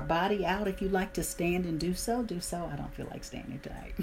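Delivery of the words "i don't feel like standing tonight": muttered aside. Real, not acted. Aside while leading warm-up.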